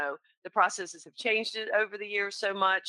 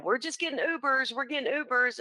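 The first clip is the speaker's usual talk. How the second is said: lightly voiced freshman, pitched higher; imitated college daughter; 7 semitones higher than usual. Freshman daughter keeps telling mother, lightly imitated in higher pitch.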